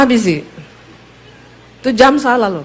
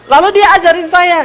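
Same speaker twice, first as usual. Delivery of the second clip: ordinary talk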